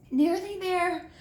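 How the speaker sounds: slow, high, out of breath; winded from hauling the crate; panting. A narrator's reading. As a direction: gasp it out, tired from the stairs. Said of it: "Harry panted".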